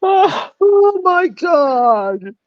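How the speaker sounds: high, loud shocked cry; shocked exclamation. Retold or imitated. Supervisor hearing the nectarine story, high shocked cry.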